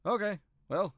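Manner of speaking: high, loud character voice; brisk rallying call, high and loud; Gigi calling the party. Acted. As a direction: round up the group with lifted pitch.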